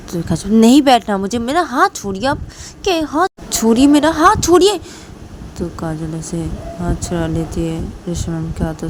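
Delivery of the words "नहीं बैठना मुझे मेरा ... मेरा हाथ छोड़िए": high, building demand to let go; angry protest. Acted. Kajal struggling free of Reshma's grip, high angry protest. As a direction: protest higher and louder as it goes.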